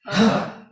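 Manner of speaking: loud, forceful exhaled huh; powerful huh. Shown as a demonstration. Leader exercising the group's voices, forceful huh. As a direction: push the breath out in one burst. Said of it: "with a powerful".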